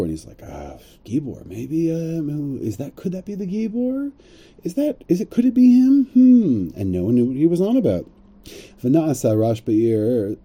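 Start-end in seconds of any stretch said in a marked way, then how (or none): 0.4-6.7 high, musing half to himself; wistful wondering. Retold or imitated. Baal Tefillah musing about the Gibor, wistful and high. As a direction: wonder aloud, puzzled and wistful. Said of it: "in a wistful way, in a wondering way".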